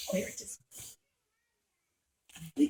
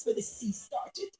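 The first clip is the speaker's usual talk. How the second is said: usual speaking voice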